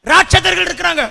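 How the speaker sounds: normal talking voice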